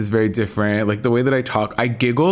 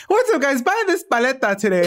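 much higher hype sales pitch; influencer video voice; 14 semitones higher than usual. Retold or imitated. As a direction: hawk the product to your followers. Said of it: "a video of me being like".

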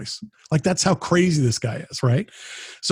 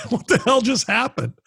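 amazed exclamation; 10 semitones higher than usual. Retold or imitated. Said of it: "And it was just like".

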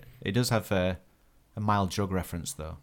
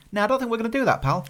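body talking back; 9 semitones higher, faster than usual. Pretend. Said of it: "my body's saying".

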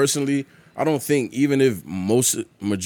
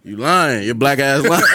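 normal talking voice

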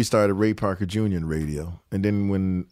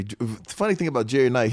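ordinary talk

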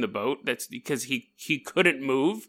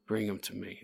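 very low, soft menacing command; stern captain; 7 semitones lower, 16 dB quieter than usual. Retold or imitated. Ship captain summoning the injured sailor, low and menacing. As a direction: growl the order like a cruel captain.

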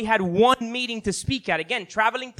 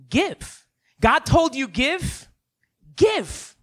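high, slow preacher's command; rising sermon call; preaching exhortation; 7 semitones higher, much slower than usual. Acted. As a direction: urge the crowd to give.

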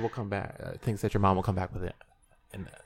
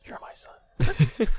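slow whispered mock mother; mock tender mom; 5 semitones higher, much slower than usual. Teasing imitation of a friend's mother, slow whisper. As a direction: breathe it out slowly as a doting mom. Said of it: "she looked at me like".

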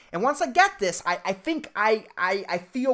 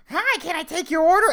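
bright forced drive-thru greeting, higher up; fake cheerful greeting; 10 semitones higher than usual. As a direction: put on the happy service voice. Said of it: "I'm supposed to act all happy".

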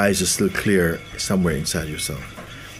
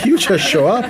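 laughing, louder and higher; 10 semitones higher, 6 dB louder than usual. Real, not acted. Laughing after joking about emailing people. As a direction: say it through real laughter.

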